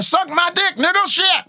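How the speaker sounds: high comic Pryor rant; Richard Pryor impression. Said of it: "Richard Pryor".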